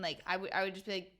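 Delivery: usual speaking voice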